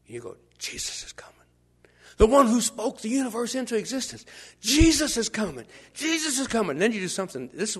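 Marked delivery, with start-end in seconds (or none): 0.6-1.4 hushed, breathless whisper; awed whisper. Pretend. Whispering awe at Jesus' approach. 2.2-6.7 higher and louder, swelling excitement; excited. Pretend. Excited blind man announcing Jesus.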